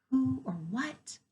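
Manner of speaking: ordinary delivery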